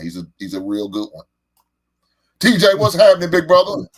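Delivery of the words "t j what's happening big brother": big loud hello, pitched up; loud greeting. Real, not acted. Host welcoming his friend on air, loud and high. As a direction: hail him as he comes on.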